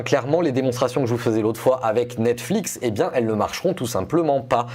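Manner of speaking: normal talking voice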